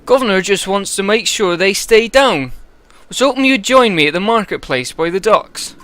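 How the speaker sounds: put-on proud steward in a thick West Country accent; West Country Eirik. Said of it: "I think West Country for this guy".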